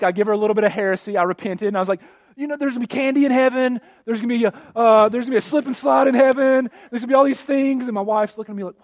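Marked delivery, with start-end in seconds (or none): 2.4-7.8 high, loud, fast frantic promises; panicked bribing. Retold or imitated. Dad bribing crying daughter with candy, frantic and fast. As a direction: rush to calm a crying child. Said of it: "in my panic".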